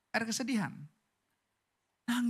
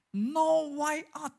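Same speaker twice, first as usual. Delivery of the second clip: dramatic; 4 semitones higher, 4 dB louder, much slower than usual. Pretend. Dramatic exclamation.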